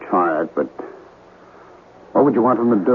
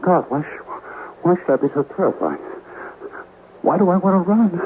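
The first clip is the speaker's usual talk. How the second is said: terrified; 9 semitones higher than usual. Acted. Terrified at the hidden room.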